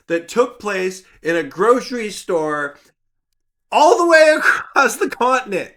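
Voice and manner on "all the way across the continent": high, loud and laughing through it; laughing exclamation. Real, not acted. To viewers about the far-off game, laughing loudly. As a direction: laugh out the words as if it's absurd.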